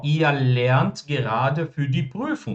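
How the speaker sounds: very slow, every German word laid out clearly; slow model sentence. Shown as a demonstration. Teacher modelling German for students, very slow and clear. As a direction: spell the sentence out slowly for the class. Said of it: "Eu tô dizendo".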